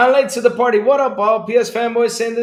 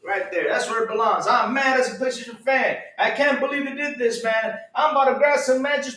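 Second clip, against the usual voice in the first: normal talking voice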